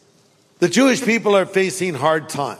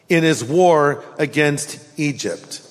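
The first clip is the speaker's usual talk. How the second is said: ordinary delivery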